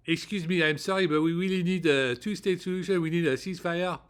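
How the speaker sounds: imitating French-accented English on the phone. French diplomat pleading with the State Department, imitated French accent. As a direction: put on a hurried, heavy French accent in English. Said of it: "Quand vous parlez comme ça à eux".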